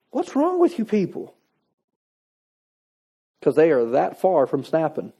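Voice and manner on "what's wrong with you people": high-pitched mock exasperation; exasperated. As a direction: act out a driver fed up with everyone. Acting out traffic rage outburst.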